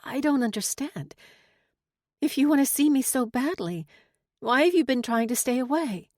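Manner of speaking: high, pleading, mildly emotional; choked-up. A narrator's reading. Teller asking why he stayed away, lightly choked up. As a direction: lift the voice as if past a lump. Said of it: "swallow down a lump in my throat before I could say".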